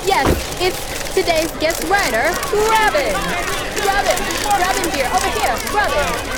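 breathless high red carpet host; gushing red carpet chatter. Acted. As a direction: gush over the stars like a TV host. Red carpet host role play.

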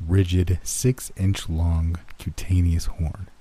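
ordinary delivery, nothing marked

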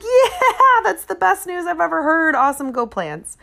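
fake delight. Mother answering son's vegan news, high forced cheer. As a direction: gush with relief that it isn't a baby. Relieved fake joy, not grandma yet.

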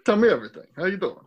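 jittery comedian impression; quick mumbled catchphrases; Rodney Dangerfield. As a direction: riff like a stand-up working the room. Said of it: "very Rodney Dangerfield feel to them".

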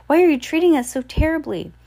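Violet cries out high and loud, pleading; high, loud, hurt outcry; crying out. A narrator's reading. Violet crying out at Esme, high and loud. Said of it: "Violet cried".

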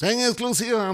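high, punchy breaking-news announcer; mock news flash. Cohost teasing his partner as a breaking-news scoop, mock announcer.

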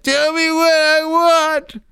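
put-on very high, drawn-out childlike wail; acted-out regressing shriek; regressing younger voice. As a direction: squeal it slowly like someone turning into a child. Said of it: "she's becoming younger and younger".

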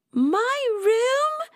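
very high, drawn-out question; shocked exclamation. A narrator's reading. Rosie hearing the room was given up, shocked exclamation. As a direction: squeal it in dismayed disbelief. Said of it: "Rosie exclaimed".

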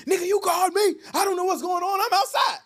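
loud, high, frantic; imitating his wife's panicked yelling. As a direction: shout it in a rush of panic. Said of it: "She's like".

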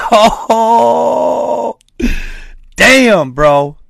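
high, loud "oh damn"; loud shocked. Real, not acted. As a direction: gasp it out, shocked.

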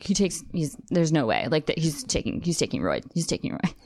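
low, soft husband imitation; grumbling husband. Friend's husband doubting the actor, low imitated grumble. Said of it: "Her husband's always like".

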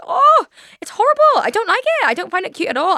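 high, loud wail of horror; distressed. Real, not acted. Reacting to old people forgetting things, distressed. Upset by films about forgetful elderly.

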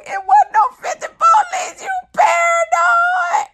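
imitated very high squeal, stretched at the end; shrill laughing shriek. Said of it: "it turns into a shrill".

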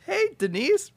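flustered Rod. Retold or imitated. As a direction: greet the ex, caught off guard. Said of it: "He's like".

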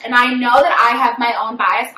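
ordinary delivery

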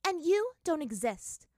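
slow, high, loud dismissal; firm. Acted. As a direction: deny her deliberately, word by word. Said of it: "said Azula firmly".

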